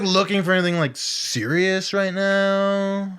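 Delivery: high, very slow excuse voice; mock noncommittal guy. Imitating the guy's excuse, high and slow. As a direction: drag it out like a guy avoiding commitment.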